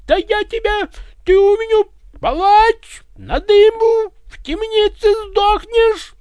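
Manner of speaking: high, loud furious yelling; the tsar threatens Ivan with the executioner; furious royal rage. Acted. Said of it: "Рявкнул царь в бешенстве".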